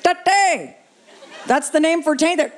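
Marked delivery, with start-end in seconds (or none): 0.0-0.8 imitated Indian accent, hesitant lead-in; mock Indian accent with a punched final word. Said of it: "there was a guy from India".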